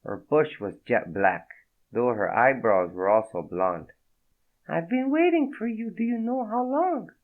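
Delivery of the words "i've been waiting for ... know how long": high, breathy-free French woman's voice, much higher; a startled French woman, voiced high; French accent. Naked woman startled by Fredo, in a high French accent. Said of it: "Thick French accent".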